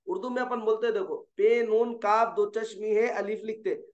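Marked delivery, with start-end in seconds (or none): none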